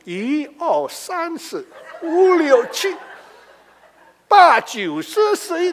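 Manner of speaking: high, loud chanted counting passed off as prayer; mock Chinese counting prayer. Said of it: "Ngomongnya satu sampai seratus".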